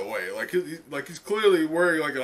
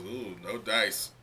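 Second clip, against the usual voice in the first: low, soft, slow shrug of a line; a hint of a smug announcer; President Mike dismissive; 6 semitones lower, 7 dB quieter, slower than usual. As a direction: drawl out the refusal. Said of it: "President Mike goes".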